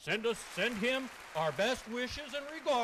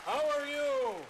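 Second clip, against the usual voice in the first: Santa's hearty greeting; 6 semitones higher, 6 dB louder than usual. Pretend. Santa greets Uncle Ducky on arrival, hearty holler.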